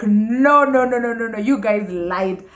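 loud, high string of no's; disbelieving and overwhelmed; shocked protest. Retold or imitated. Bride-to-be catching her friends' trick, loud shocked protest.

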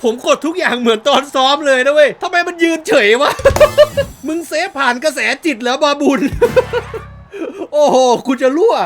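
loud, high words broken by laughter; real cackling gamer laughter; laughing hard. Real, not acted. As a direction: laugh through it in disbelief. Laughing at keeper's blunder.

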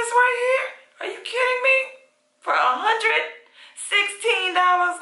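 can you believe this price, squeal it; amazed squeal. Real, not acted. Raving about the low price.

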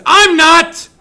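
mock despair yelled at the class; sudden yell. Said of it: "I can just yell randomly".

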